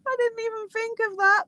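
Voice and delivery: giggling high through the words; can't stop laughing while talking. Real, not acted. Delighted fan laughing at friend's ride joke, laughing.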